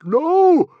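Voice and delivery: high, very loud cry of denial; anguished shout. A narrator's reading. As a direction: shout it out long in horror. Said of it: "shouted Stoic".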